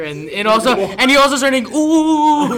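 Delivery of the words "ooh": high imitated monkey "ooh"; monkey hooting. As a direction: hoot like an excited ape. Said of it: "started scratching his armpits like a goddamn monkey".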